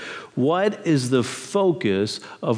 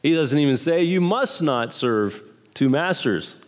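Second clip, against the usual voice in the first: ordinary talk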